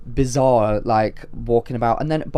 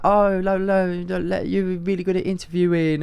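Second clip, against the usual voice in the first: higher, mumbly mock London accent; badly faked London accent, pitched up; London accent impression; 6 semitones higher than usual. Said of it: "I can't do his accent".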